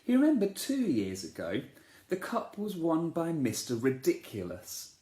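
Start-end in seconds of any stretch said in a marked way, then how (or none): none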